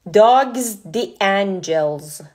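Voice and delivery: "Dogs the Angels" drawn out very slowly in a Persian accent; Persian-accent English. Shown as a demonstration. Host spelling the page name for typing, slow Persian-accent English.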